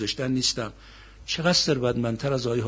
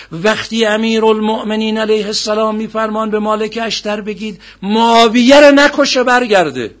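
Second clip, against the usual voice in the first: loud, high-pitched sermon narration; impassioned preaching; 8 semitones higher, 13 dB louder than usual. Acted. Preacher telling of Ali's order to Malik, impassioned preaching. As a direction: push the story out at full force.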